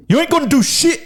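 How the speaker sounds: high-pitched put-on Irish accent; Conor McGregor. Host warms up for the Irish challenge, imitating a fighter's Irish accent. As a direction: blurt it in a mock Irish fighter voice. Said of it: "Conor McGregor. How does he talk?".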